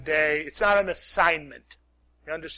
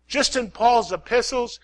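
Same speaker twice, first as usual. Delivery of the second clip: ordinary talk, nothing marked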